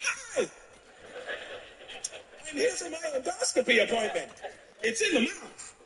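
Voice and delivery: high, soft, timid voice of a well-behaved normal patient; polite civilian impression. Comedian mimics audience checking in for endoscopy, high and timid. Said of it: "do my impression of how I think you would handle this situation".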